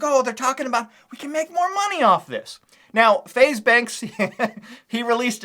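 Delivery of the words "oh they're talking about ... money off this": high mock voice of a scheming team member. YouTuber mocking Haley's team, high mock voice. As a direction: mimic the team cashing in, pitched up. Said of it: "They're like".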